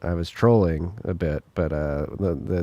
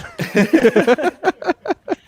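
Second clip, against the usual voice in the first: laughter; 4 dB louder than usual. Real, not acted. Cohost jokes about connection issues.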